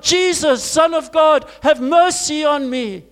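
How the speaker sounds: desperate shout for mercy. Retold or imitated. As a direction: shout it out, pleading. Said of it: "the louder he got".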